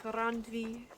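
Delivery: Randvi with a trilled rolled r. Shown as a demonstration. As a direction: roll the r in Randvi hard. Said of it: "how much I roll my R's".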